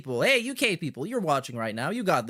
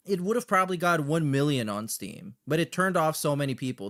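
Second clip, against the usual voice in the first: ordinary delivery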